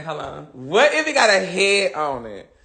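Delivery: usual speaking voice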